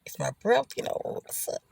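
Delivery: soft, breathless self-check under the breath; muttered aside. Retold or imitated. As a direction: mumble it to yourself, half joking. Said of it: "You be like".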